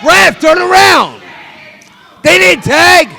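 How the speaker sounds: high, slow desperate shout at the ref; pleading with the referee. Real, not acted. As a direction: yell up at the referee, begging. Fan yells at the referee.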